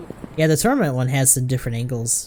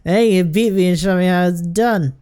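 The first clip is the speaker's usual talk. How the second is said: loud, higher, boastful slur; belligerent drunk; 6 semitones higher, 4 dB louder than usual. Acted. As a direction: throw down a drunken challenge.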